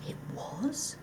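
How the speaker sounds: hushed hungry whisper; barely hide his hunger; greedy whisper. Acted. The fox whispering greedily about the ducks.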